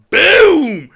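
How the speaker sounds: loud, high "Boom!" punched out; slam sound effect. Retold or imitated. Describing hitting the mat, loud mock impact sound. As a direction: bark the impact like a crash. Imitating being slammed down.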